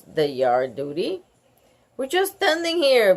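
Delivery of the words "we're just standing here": yelled. A narrator's reading. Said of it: "Bean yelled".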